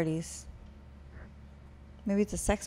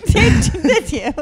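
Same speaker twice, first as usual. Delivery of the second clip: laughing disbelief; 10 semitones higher, 11 dB louder, slower than usual. Real, not acted.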